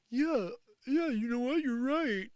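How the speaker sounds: high, sarcastic imitation of a gullible fan; mock agreement. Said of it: "be like".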